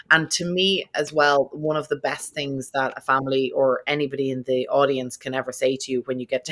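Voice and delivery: ordinary talk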